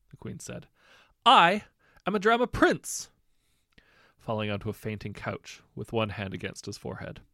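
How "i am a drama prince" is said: much higher and louder, theatrical proclamation; grand declaration. Acted. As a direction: announce it like an overblown stage hero. Prince declares himself dramatically.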